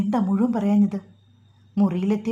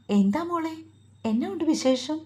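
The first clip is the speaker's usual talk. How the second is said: higher-pitched, lightly voiced grandmother; grandmother on phone; 7 semitones higher than usual. Grandmother asks caller for news, lightly voiced grandmother. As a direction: greet the caller in a lifted grandmother voice.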